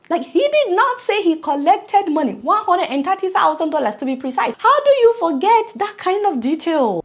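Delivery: loud, high disbelief; indignant outburst. Real, not acted. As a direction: rail at the missing detail, voice up. Indignant about the missing fee.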